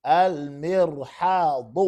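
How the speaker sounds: slow, stretched-out model word; slow dictation. Shown as a demonstration. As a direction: draw out each syllable for the class. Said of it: "it's dictation in Arabic".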